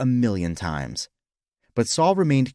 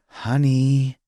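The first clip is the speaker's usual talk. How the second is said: drawn-out, higher wife's voice; wifely "honey", lightly raised; coaxing wife; 4 semitones higher, 3 dB louder than usual. Acted. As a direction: call to a husband, stretched out. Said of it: "his wife said".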